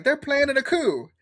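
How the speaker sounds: put-on a teasing take on a sudden Alabama accent; imitating Southern accent. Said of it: "Rob's Southern accent".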